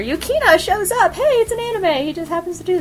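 high-pitched, breezy excusing of the plot; high comic aside. Real, not acted. Fan explaining a convenient anime entrance, high and comic.

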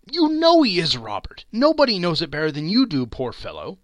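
scornful. Acted. Wife scorns Scrooge to Bob.

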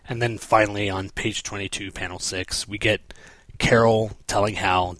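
ordinary talk, nothing marked

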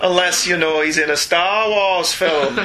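slow, put-on Scottish brogue; funny Scots accent. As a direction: ham up a Scots accent, drawing words out. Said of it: "in a funny Scots accent".

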